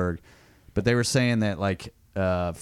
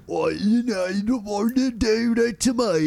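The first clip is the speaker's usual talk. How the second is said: high, drawn-out mock Cockney garble; guttural Cockney accent; 11 semitones higher than usual. Shown as a demonstration. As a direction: exaggerate a barely intelligible Cockney accent. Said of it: "It's all guttural".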